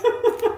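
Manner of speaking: short, high, loud burst of laughter; quick high giggle. Real, not acted. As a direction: laugh brightly along. Laughing with the host.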